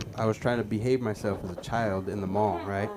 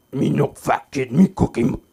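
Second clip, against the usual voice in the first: Cookie Monster voice; 5 semitones higher, 13 dB louder than usual. Retold or imitated. Boy answering the waiter as Cookie Monster. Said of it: "All right, Cookie Monster".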